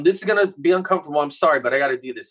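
quick doctor apology. Retold or imitated. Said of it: "I'm like, he's on".

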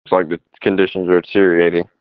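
usual speaking voice

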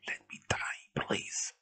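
faint, voiceless begging; weak whispered plea. A narrator's reading. Wounded Andy begging Milo, weak whisper. Wounded boy begs to die.

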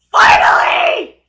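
long drawn-out shouted finally; booming delighted boss cry; excited shout. Acted. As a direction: belt it out, stretched slow. Boss exclaims on arrival.

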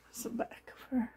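soft hushed whisper. Real, not acted. Whispering over the sleeping doll.

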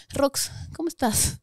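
slow, soft, unsure how to greet her; slow and soft awkward hello; awkward hesitant greeting. Retold or imitated. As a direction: ask it gingerly, not knowing what to say. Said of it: "no sabía disimularlo".

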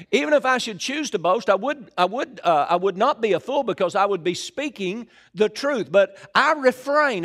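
preacher reading out Scripture with force. Acted. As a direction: declaim the verse, landing hard on the end. Reads scripture aloud to congregation.

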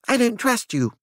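high, wild distrust. A narrator's reading. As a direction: accuse her, losing control. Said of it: "his voice still wild".

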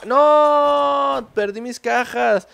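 sharp high yell of dismay; loud, high "no" of dismay; dismayed shout. Real, not acted. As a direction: cry out as the boxes vanish. Losing his boxes in game.

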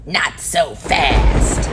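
slow, menacing hiss; a hint of a mean teacher; unpleasant hiss. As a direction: cut them off with a nasty hiss. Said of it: "hissed an unpleasant voice".

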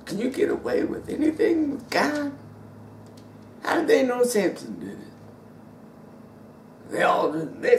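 ordinary delivery

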